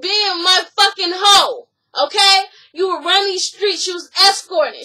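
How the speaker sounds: loud, with the first line slowed down; mock furious insult. Acted quarrel, insult.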